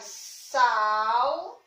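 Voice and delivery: "sal" stretched out as a separate syllable. Shown as a demonstration. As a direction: sound out "sal" alone, held long. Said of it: "se a gente fosse separar a palavra".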